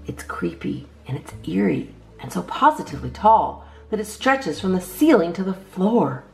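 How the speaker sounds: ordinary delivery, nothing marked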